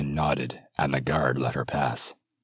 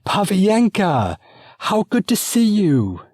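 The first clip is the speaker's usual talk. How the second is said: much higher, slow, gushing delight; elated greeting; 9 dB louder, slower than usual. Acted. Princess greeting her dear friend, high and elated. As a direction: greet an old friend with rapturous joy. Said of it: "with an elated gasp".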